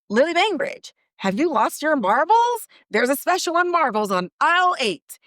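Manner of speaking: playful menace in a light, lifted voice; taunting announcer. Retold or imitated. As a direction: tease her cheerfully like a creepy host. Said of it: "he says".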